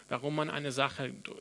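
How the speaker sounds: ordinary delivery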